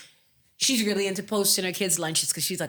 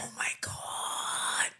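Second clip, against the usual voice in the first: whispering; 9 dB quieter, much slower than usual. Real, not acted. Gushing over kids in costume.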